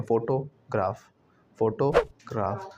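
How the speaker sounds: slow फोटोग्राफ split as photo, graph; broken into parts. Shown as a demonstration. Teacher showing the old habit, slow split pronunciation. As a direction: say फोटोग्राफ slowly with a break after photo. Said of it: "फोटो पे थोड़ा ग्राफ जोड़ा".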